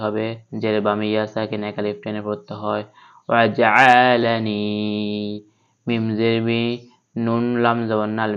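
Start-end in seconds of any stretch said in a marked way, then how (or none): none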